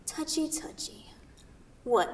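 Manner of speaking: whispered taunt; teasing whisper. Acted. Sarah needles Kent after his retort, in a teasing whisper. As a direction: breathe it out as a tease.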